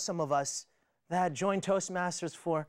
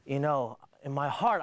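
usual speaking voice

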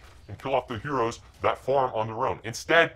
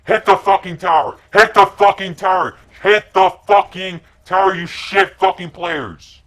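very loud, higher repeated yelling at the team; furious shout; 6 semitones higher, 11 dB louder than usual. Real, not acted. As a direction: scream the order over and over. Screaming at team to hit tower.

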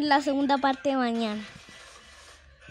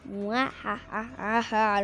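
slow villain cackle; hammed-up evil cackle; evil laugh; 5 semitones lower, slower than usual. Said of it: "no sabe lo que se le viene".

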